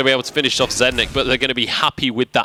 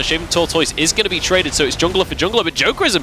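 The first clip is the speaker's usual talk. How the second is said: excited play-by-play; 9 semitones higher than usual. Acted. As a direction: call the teamfight as it explodes. Teamfight play-by-play.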